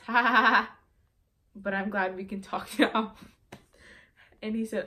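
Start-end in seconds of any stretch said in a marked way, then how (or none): 0.1-0.8 loud, exaggerated ha-ha laughter; hammed-up laugh. Reads out her texted laugh, hamming it. 1.5-3.1 laughing. Retold or imitated. Laughs while reading her own text.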